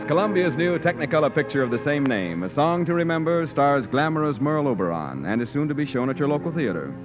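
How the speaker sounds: ordinary delivery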